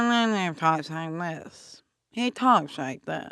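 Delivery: low, slow, drawn-out comic impression; Adam Sandler. Comic showing how sleep mode slows an actor, low slurred impression. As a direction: drag it out in a low, distorted voice. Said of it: "That's a great Adam Sandler impression".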